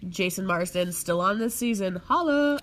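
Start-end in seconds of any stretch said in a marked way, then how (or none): 2.0-2.6 playful holla. Pretend. Playful shoutout.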